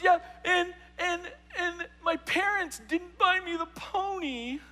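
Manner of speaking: put-on high, slow crying-girl whine; mock bawling. Bawling girl complaining to youth leader, mock sobbing. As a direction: sob it out like a spoiled teen, hammed-up. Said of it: "she's just bawling".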